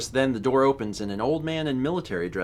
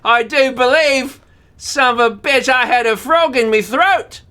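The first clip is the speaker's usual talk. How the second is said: louder, higher hammed-up British chap; British accent; 11 semitones higher, 8 dB louder than usual. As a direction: play it as a jolly Englishman. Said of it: "With his accent".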